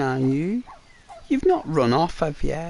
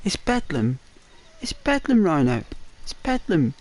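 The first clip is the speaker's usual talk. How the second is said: put-on London accent; 2 semitones higher than usual. To viewer Rhino, showing off a put-on London accent. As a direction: play a cheeky London lad, mate. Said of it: "I thought you'd appreciate the London accent".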